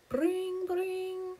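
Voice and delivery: high ringing bring bring; phone ring imitation. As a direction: ring like a telephone.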